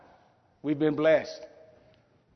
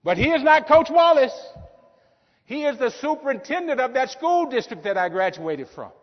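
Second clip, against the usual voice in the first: high, loud, rushing with joy; overwhelmed excitement; 9 semitones higher, 3 dB louder than usual. Retold or imitated. Speaker retelling meeting his old coach, high excited. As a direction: relive the thrill of seeing him again.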